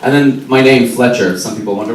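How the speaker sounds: ordinary talk, nothing marked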